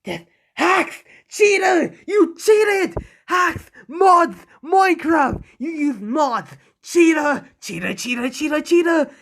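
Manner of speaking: high, loud screaming tantrum of cheater accusations; raging villain meltdown, very high and loud; furious tantrum. Acted. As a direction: shriek it like a sore loser who just lost. Villain raging after losing.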